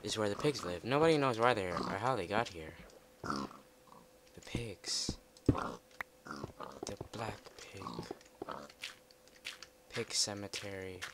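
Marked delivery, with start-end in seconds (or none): 0.0-2.7 mock legend telling. Spooky storytelling about pigs. 3.2-7.8 low, slow eerie whisper; hushed, creepy and slow; spooky whisper. Pretend.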